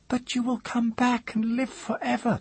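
very high, thin, insistent voice; awed woman. A narrator's reading. Woman insisting the mage is immortal, high and thin. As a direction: press him, high-pitched.

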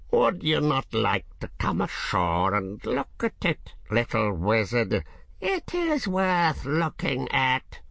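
sly dragon coaxing him ashore. A narrator's reading. Dragon tempts Ged.

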